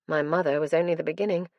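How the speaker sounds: ordinary delivery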